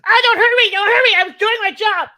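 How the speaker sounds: high, loud terrified squeal; panicked goblin. Acted. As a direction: shriek for mercy like a cornered goblin. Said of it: "it turns around and goes".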